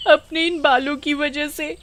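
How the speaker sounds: high, pleading mock sob; fake crying. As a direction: wail pitifully to win sympathy, faking it. Vimla fake-crying to the witch. (झूठ-मूठ का रोते हुए)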